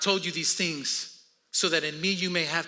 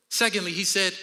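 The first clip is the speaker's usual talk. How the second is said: usual speaking voice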